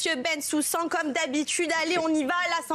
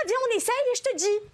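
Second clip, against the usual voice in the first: coaxing; 7 semitones higher, faster than usual. Acted. Husband urging the switch.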